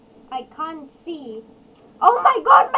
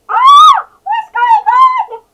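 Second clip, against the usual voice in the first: very high, loud panicked shriek; startled scream; 24 dB louder, slower than usual. Real, not acted. Player startled as the live event begins, panicked scream. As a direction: scream out in sudden alarm.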